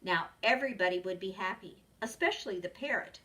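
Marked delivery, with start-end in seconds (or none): none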